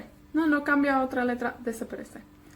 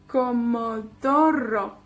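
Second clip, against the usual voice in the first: slow Comodoro with DO stressed; co-mo-DO-ro, drawn out; stress on penultimate; slower than usual. Shown as a demonstration. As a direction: stretch Comodoro and lean on DO. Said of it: "cae en la penúltima sílaba".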